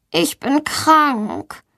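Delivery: drawn-out, pitiful sickly complaint; suffering voice. A narrator's reading. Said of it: "sagt Valentina mit leidender Stimme".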